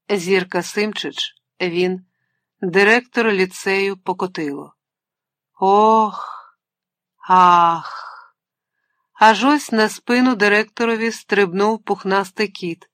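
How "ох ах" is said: hammed-up moans of passion; mock passionate ох and ах, louder; moaning. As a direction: sigh them out like lovers in the dark. Lovers moaning in a dream.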